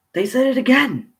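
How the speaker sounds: "again" cried out high and loud, dropping at the end; incredulous exclamation. Real, not acted. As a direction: exclaim "They said it again" in stunned disbelief.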